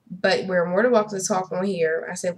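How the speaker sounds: ordinary talk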